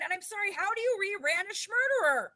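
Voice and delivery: high, laughing disbelief; incredulous laugh. Real, not acted. Host laughing to cohost at absurd murderer rebrand, high.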